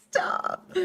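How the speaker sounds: high squealing laugh. Real, not acted.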